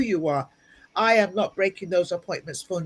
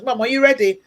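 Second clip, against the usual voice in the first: higher, louder impatient call to mum; nagging daughter; 6 semitones higher, 6 dB louder than usual. Retold or imitated. Daughter hurrying mum to the gym, nagging. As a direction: imitate a daughter chivvying her mother. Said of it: "My daughter says".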